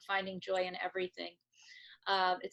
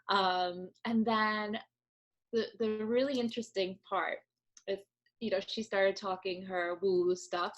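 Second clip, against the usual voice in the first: usual speaking voice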